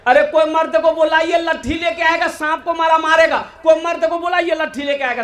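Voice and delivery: high-pitched imitation of panicked women; women crying out. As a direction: mimic women calling for help, pitched high. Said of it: "औरतें चिल्लाने लगी". (चिल्लाती औरतों की ऊँची नक़ल)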